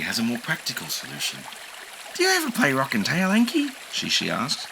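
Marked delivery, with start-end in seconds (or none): 2.1-3.7 high playful question; bird creature. A narrator's reading. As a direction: ask with a lift. Shishi voice.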